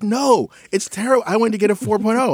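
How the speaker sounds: mock distraught teenager; upset daughter. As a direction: imitate her upset daughter. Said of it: "She's like".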